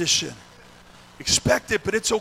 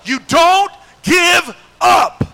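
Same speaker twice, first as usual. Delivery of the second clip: high, very slow emphatic shout; like you mean it; 10 semitones higher, 10 dB louder, much slower than usual. Shown as a demonstration. Preacher shows congregation how to say it, emphatic shout. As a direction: shout each word as a rallying cry. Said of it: "Say it like you mean it".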